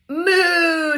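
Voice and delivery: high, loud, drawn-out moo; long playful cow call. Acted. Voicing the cow.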